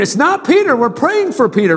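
high, impatient dismissal; exasperated mock retort. Church member shooing away Peter at the door, mock exasperation. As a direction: brush him off, busy praying.

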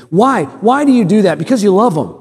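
ordinary talk, nothing marked

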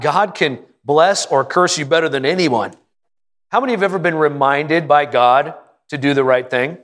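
ordinary talk

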